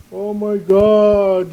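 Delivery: loud, high, drawn-out wail; hammed-up alarm, stretched slowly; mock hysterical outcry. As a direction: cry out in exaggerated horror. Mocking the opponents' overblown reaction.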